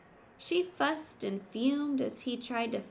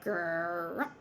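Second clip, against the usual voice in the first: low grumbling hiccup sound; hiccup grunt; 3 semitones lower than usual. Acted. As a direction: burp out a low grumph like a badger. Badger's loud hiccup.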